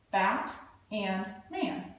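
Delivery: Bat and man, slow, each part apart; slow split word. Shown as a demonstration. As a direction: drag the split slowly so kids hear both parts. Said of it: "break Batman into two parts".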